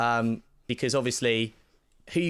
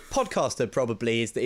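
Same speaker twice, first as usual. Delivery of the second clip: normal talking voice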